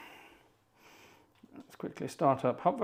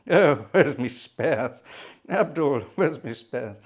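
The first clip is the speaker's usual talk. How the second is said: hammed-up comic officer, loud and drawn out at first; Bloodnok voice; 6 dB louder, slower than usual. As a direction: call out slowly in character, then ease off. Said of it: "I have to get back in the mood for that".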